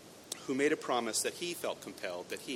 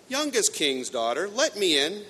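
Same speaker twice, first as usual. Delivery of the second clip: higher, louder, rising call; crying at the door; 9 semitones higher, 11 dB louder, slower than usual. Acted. Frog calling through the door, higher loud call. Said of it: "a voice crying".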